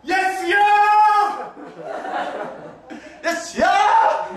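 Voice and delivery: high, very slow, near-sung cry; drawn-out cry. Shown as a demonstration. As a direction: stretch it almost singing, laughing. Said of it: "시작!". (높고 아주 길게 노래하듯 외치기)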